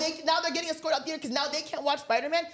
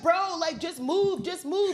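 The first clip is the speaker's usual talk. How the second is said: higher-pitched mock pleading; exasperated moviegoers; 5 semitones higher, slower than usual. Audience telling the quarrelling pair to move, exasperated. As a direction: imitate fed-up people urging someone along.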